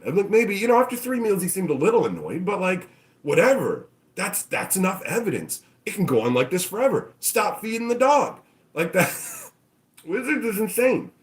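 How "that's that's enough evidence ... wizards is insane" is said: normal talking voice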